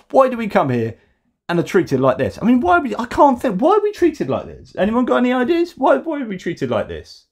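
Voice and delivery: exaggerated high wail of a wronged holidaymaker; mocking whiny tourist. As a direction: bleat the question like a spoilt tourist.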